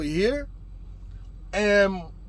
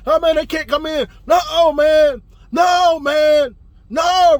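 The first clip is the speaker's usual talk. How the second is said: raised, loud panicky refusal; yelling; 6 semitones higher, 7 dB louder, slower than usual. Retold or imitated. Roommate keeping police out of the crib, yelling. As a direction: shout over everyone, refusing. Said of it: "It's just yelling and screaming".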